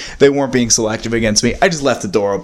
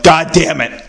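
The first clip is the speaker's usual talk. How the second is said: high, louder retold outburst of frustration; pissed; 5 semitones higher, 4 dB louder, slower than usual. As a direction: act out cursing at a dead recorder. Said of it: "I start getting pissed".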